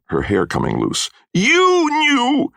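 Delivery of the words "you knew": high, loud, drawn-out shouting; shouted accusation. A narrator's reading. Mother blaming Missus Grummick for the deaths, shouting. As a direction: shout it like a mother wild with grief and blame. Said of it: "my mother shouted, struggling".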